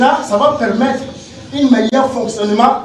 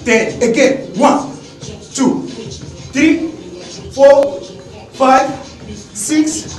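fitness coach counting; 3 semitones higher, much slower than usual. Acted. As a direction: call out reps to the class.

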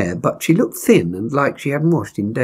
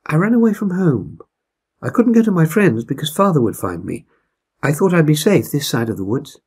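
put-on higher, hesitant teenage girl voice; a frightened girl explaining why she ran; runaway girl confiding to the bear family; young Gilda, a runaway girl; 4 semitones higher than usual. Gilda tells why she fled.

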